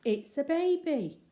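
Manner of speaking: loud, high and slow in amazement; astonished. Acted. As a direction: gasp it out, stunned to find a baby. Bill discovers baby, amazed.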